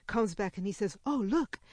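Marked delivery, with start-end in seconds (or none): 1.1-1.6 higher, louder exclamation of discovery; acted burst of excitement; excited discovery. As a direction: call out an eager find to the group. Wild fairy makes a discovery.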